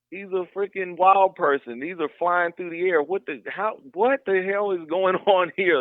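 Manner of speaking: retold bewilderment, pitch lifted; incredulous. Camper answering his friend about the flying lights, incredulous.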